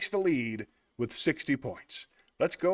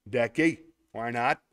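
ordinary talk

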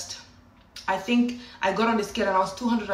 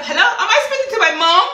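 stunned disbelief; 11 semitones higher, 9 dB louder, faster than usual. Retold or imitated. Shocked by mom's approval.

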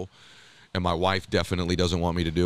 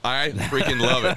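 louder, laughing through the words; can't contain the glee; laughing delight; 3 semitones higher, 4 dB louder than usual. Real, not acted. Host cheering the guest's run for governor, laughing.

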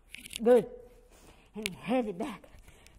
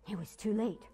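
ordinary delivery, nothing marked

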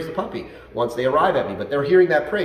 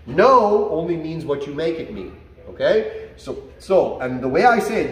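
ordinary talk, nothing marked

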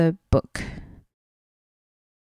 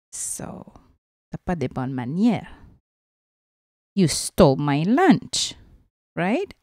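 plain reading voice

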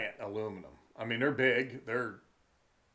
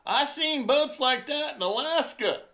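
high, loud mocking voice; mock punk taunting from his boat; mimicking punk dude; 14 semitones higher, 7 dB louder than usual. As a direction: ham up the punk's sneer. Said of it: "He says".

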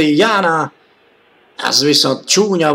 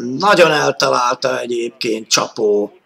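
ordinary talk, nothing marked

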